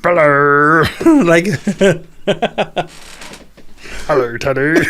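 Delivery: Bean's mumbled hello to Teddy; Mister Bean voice. Acted. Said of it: "is this Mister Bean?".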